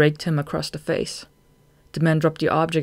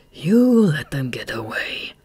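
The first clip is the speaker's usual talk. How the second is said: slow, harsh accusing tone; contemptuous accusation; 4 dB louder, slower than usual. A narrator's reading. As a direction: spit blame at the underling slowly. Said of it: "He looked contemptuously at Throb".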